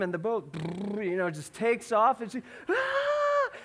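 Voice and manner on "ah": high, loud mock scream; sudden high-pitched imitated screech; imitated child's scream. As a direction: shriek like a frightened three-year-old. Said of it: "And starts to scream".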